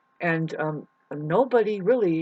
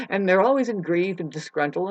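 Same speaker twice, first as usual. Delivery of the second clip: ordinary delivery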